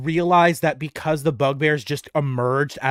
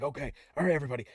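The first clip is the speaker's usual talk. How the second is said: hushed huddle; 11 dB quieter, much faster than usual. Acted. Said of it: "he's like".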